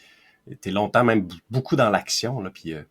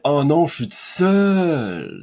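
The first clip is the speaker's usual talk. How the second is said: drawn-out, higher mock despair; slow pitiful whine; mock lonely lament; 5 semitones higher, 3 dB louder, much slower than usual. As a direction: sigh it out like someone alone and miserable.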